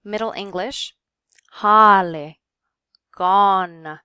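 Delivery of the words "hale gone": "hale" and "gone" loud, with a long open O. Shown as a demonstration. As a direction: drop the jaw for the vowel in "hale" and "gone", like holly. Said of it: "your jaw drops when you say that".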